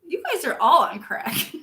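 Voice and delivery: loud teasing protest; playful scolding. Real, not acted. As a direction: scold the chat with a grin. Jokingly scolds chat over compliment.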